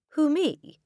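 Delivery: high, puzzled little question; genuinely puzzled. A narrator's reading. Accused boy answering the headmistress, high and puzzled. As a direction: squeak it in innocent disbelief. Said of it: "looking genuinely puzzled".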